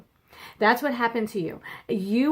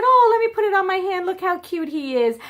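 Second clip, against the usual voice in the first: high, quick delighted coo; charmed parent; 9 semitones higher, 4 dB louder than usual. Retold or imitated. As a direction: show the kids a spider is adorable.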